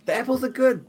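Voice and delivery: high-pitched gleeful character voice; excited party call. Acted. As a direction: call a friend to celebrate. Player character joins the celebration.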